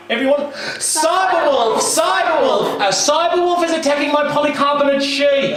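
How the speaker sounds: mock alarm, yelling for the village; crying out. Boy yelling to villagers, fake panic call. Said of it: "he cried out".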